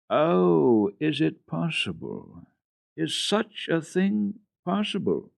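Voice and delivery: put-on high, slow old woman's amazement; wondering grandmother. A narrator's reading. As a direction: marvel slowly in a high old voice. Grandmother marvelling.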